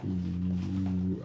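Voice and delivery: ordinary talk, nothing marked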